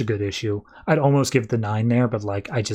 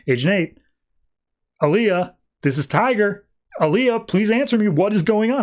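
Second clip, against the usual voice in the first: retold mounting panic, pitch climbing higher; freaking out; 8 semitones higher than usual. Agent One calls for an unanswering Aaliyah, increasingly frantic. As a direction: plead urgently into the comms, acting it out. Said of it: "starts freaking out more and more".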